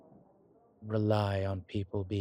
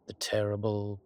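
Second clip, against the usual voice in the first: ordinary delivery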